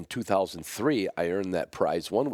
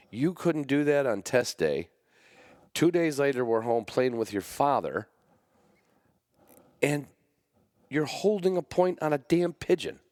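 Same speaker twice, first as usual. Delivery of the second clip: higher, mock-scolding the dog; 7 semitones higher than usual. Owner teasing his dog for failing on test day, mock scolding. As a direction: tell the dog off with wry disbelief. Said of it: "I'm like".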